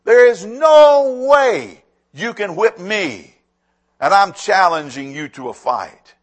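loud, slow, bellowing giant's challenge; Goliath's taunt. Retold or imitated. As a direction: boom it out across the valley. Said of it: "and he says".

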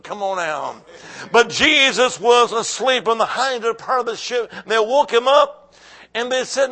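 loud, driving sermon delivery; full-force preaching. Real, not acted. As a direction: preach it hard to a full church.

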